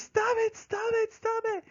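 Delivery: very high-pitched panicky imitation of wife; hammed-up falsetto panic, repeated frantically; mock freaking out. Wife under diving seagulls, imitated high panicked squeal. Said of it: "she's freaking out".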